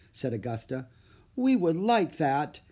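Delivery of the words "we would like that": slow, higher, mildly pitiful plea. A narrator's reading. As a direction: play the poor tired child. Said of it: "Annie said pitifully".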